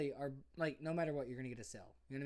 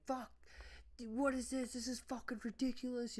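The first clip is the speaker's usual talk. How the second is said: high, loud imitation of a boss chewing someone out; mock screaming; 12 semitones higher, 4 dB louder than usual. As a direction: act out an angry cussing-out, pitched way up. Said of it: "cussing him out, screaming".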